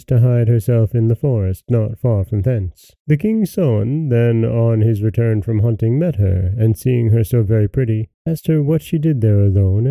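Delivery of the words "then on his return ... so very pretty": ordinary delivery, nothing marked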